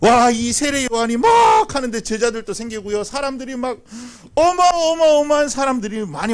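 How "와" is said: normal talking voice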